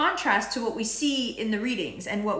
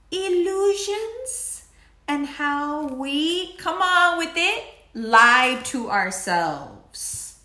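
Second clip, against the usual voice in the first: much slower, higher, stretched words; dramatic drawl; 7 semitones higher, 5 dB louder, much slower than usual. Acted. Reading Neptune's illusions to viewers with slow drama. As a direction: stretch them out mysteriously.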